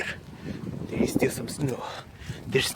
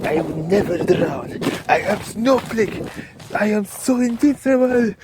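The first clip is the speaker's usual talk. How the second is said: boastful; 7 semitones higher than usual. Pretend. Claiming he will never drown, hammed-up boast. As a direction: puff up like a superhero.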